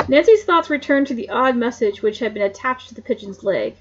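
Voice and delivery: plain reading voice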